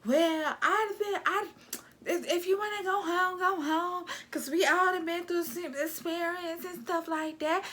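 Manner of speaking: high mocking whiny voice of a petty housemate; mocking Sarah. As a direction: mimic her high and whiny, complaining about crumbs. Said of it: "being a little cockroach as she is".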